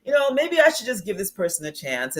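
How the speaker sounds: high, sighed "oh"s, then tentative reasoning; mock second-guessing; wavering self-doubt. Voices the too-trusting heart's second thoughts.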